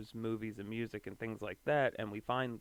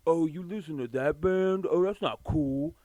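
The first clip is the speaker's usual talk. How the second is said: higher, louder mocking teen gatekeeper; high school snob; 6 semitones higher, 8 dB louder than usual. His high school self judging someone's band, mocking. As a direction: put on a sneering judgmental voice, raised pitch. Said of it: "that whole, like, high school [name]".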